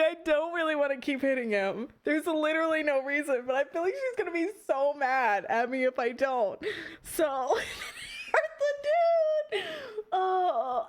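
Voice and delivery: ordinary delivery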